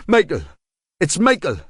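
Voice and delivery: high, tense local voice, calming a little; grudgingly offering his name; suspect giving his name. A narrator's reading. Armed suspect telling Marshall his name, tense local voice.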